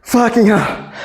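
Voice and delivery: panting after a heavy set; out of breath. Real, not acted. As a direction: gasp it out, winded. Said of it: "Squatting heavy is cardio".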